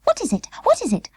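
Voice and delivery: high, thin doll's worried question; small doll voice. Acted. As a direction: ask it in a tiny doll's voice. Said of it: "asked Birdie".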